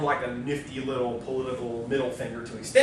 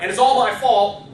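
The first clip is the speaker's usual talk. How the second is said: raised, louder imagined admission; play-acted loud admission; mock confession out loud; 6 semitones higher, 7 dB louder than usual. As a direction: blurt out the confession, higher and louder.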